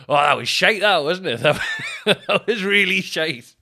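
loud, high, breaking into laughter; laughing self-mockery. Comedian to crowd after a joke flops, laughing admission. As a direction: blurt it out loudly through your laughing.

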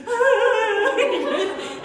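high loud mock scream; panicked yell. Imitating her little brother, a loud high panicked ah. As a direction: yelp like a panicked kid. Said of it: "He just goes".

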